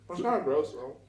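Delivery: talking through a mouthful; words round a bite of food; mouth full. Real, not acted. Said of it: "Can I talk with my mouth full?".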